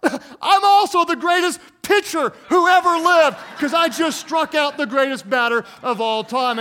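high, shouted self-congratulation; triumphant boastful kid. Acted. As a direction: crow it loudly like a kid turning failure into victory. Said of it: "it's like the kid who".

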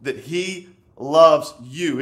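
slow, loud stress on LOVES; emphatic. Acted. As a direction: stretch "He loves you" and punch it out. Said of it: "the Word of God is emphatic".

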